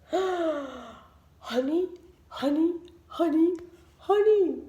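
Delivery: from murmur to eager honey cry; building honey craving. A narrator's reading. Norman bursts at honey sight.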